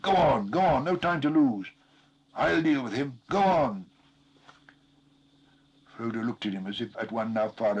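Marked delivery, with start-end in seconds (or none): 0.0-3.9 loud, higher urging; urgent gasp. A narrator's reading. Sam urges Frodo on, urgent and loud. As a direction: hurry him along breathlessly. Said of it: "he gasped".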